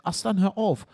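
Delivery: retelling mum yelling at the dog; mother's shout. Retold or imitated. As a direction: call the dog off sharply, as mum did. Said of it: "Meine Mutter schreit".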